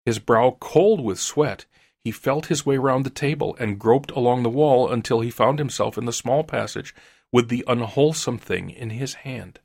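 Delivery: ordinary delivery, nothing marked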